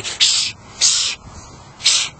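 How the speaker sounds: repeated sharp shushes; shushing. Pretend. Vlogger breaking off mid-sentence to shush. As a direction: cut in with shushing noises.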